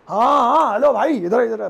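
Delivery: loud call across the room to a brother. Acted. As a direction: shout to get his attention. Calling someone over in skit. (ज़ोर से आवाज़ लगाते हुए)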